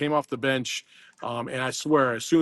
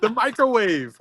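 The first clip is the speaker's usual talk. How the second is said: excited; 9 semitones higher, 6 dB louder, slower than usual. Acted. Hyping the nickname.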